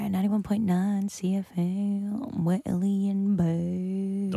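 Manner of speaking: slow, drawn-out radio ident delivery; slow hammed-up station jingle; mock jingle voice. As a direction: croon it like a cheesy station sting.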